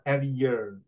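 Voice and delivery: "every year" with an American-style R on "year"; imitated R pronunciation. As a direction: copy the student's rounded R ending "year". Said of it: "the way you pronounce the R".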